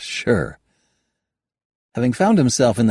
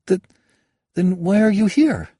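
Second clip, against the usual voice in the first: higher, flustered stammer; 13 semitones higher, 4 dB louder than usual. Acted. Kayada stammers a nervous question to the stranger. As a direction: trip over the first word nervously. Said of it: "she stammered".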